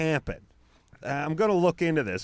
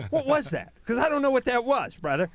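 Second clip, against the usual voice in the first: much higher, louder, hammed-up rant; mock outraged guy yelling through the wall; mock angry scolding; 10 semitones higher, 4 dB louder than usual. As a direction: play a guy chewing out his own penis. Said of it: "then you hear him through the wall".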